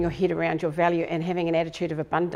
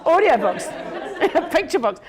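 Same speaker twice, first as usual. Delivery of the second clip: laughing joke; 11 semitones higher, 6 dB louder than usual. Real, not acted. Joking, laughing loudly.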